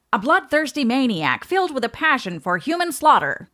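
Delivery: lightly voiced 1950s narrator, high and punchy; a hint of an old newsreel announcer; old-time newsreel voice. Shown as a demonstration. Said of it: "in your old-time newsreel voice".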